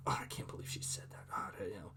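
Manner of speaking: hushed, breathy aside to oneself; whispered indignant thought; whispered inner thought. Retold or imitated. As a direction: whisper it like a private grumble. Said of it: "I'm set off".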